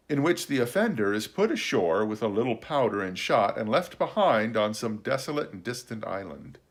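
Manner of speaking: plain reading voice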